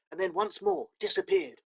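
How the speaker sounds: usual speaking voice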